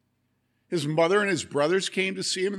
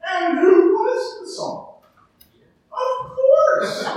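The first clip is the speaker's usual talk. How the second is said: excited cheer; 13 dB louder, much slower than usual. Real, not acted. Kids finally answer.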